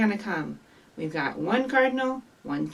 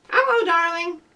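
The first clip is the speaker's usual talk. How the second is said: high-pitched talking parrot; 7 semitones higher, 4 dB louder than usual. Acted. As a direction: say it like a pet parrot. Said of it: "Do your very best parrot voice".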